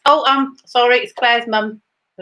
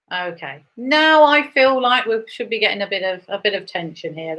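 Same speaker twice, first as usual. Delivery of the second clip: usual speaking voice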